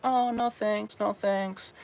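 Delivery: high, soft coy no thanks; hammed-up girl smiling while saying no; playful refusal imitation. Imitating a Japanese girl's soft playful no, high and soft. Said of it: "still kind of smiling".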